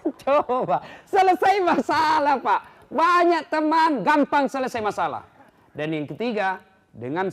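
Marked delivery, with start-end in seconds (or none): 0.1-5.3 loud, high, slow and emphatic; full-force preaching. Acted. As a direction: press each word like a lesson. (khotbah lantang dan pelan menekan)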